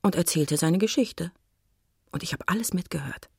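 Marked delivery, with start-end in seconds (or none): 2.1-3.3 quick confiding whisper. Acted. Narrator admits he overheard everything, whispering. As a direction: let the listener in on a secret.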